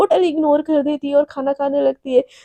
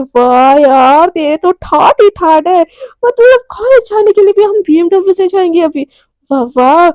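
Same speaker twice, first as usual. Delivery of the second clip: impressed delight; 2 semitones higher, 4 dB louder than usual. Acted. Romi awed by riding to college in BMW, thrilled. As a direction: marvel at your friend's luxury.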